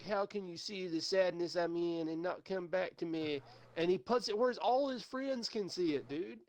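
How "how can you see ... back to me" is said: higher, hammed-up whiny pleading; mock self-pitying plea to the ex; mock sad Facebook post. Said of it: "And it's like".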